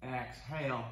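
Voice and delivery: usual speaking voice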